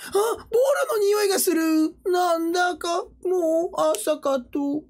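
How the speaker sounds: very high little cartoon character voice; high and drawn out, slowing at the end; Hachiware. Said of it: "八割れ洞窟に住んでんのがいい".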